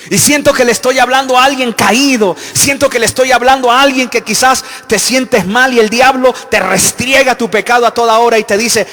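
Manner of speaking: loud, slowed sermon cry; fervent preaching. Acted. Preacher calling to a fallen listener in the church, loud and fervent. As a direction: pour out fire on the fallen.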